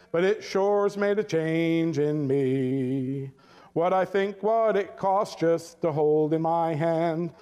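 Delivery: slow, low, hushed recitation of a poem; recited poem. Acted.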